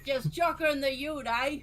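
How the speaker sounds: high, loud Aussie bogan; Bogan Australian. Pretend. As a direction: echo it in a broad Aussie accent. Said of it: "full-on Outback style".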